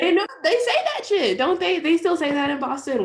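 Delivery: high, loud insistence; insistent protest. Real, not acted. Cohost defending Boston slang to a doubter, loudly insistent. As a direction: raise your voice high to argue back.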